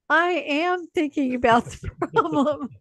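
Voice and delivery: high, loud laughing answer; laughing reply. Retold or imitated. Colleague answering the coach, laughing out loud. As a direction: blurt it out while laughing. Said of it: "we're hysterical laughing".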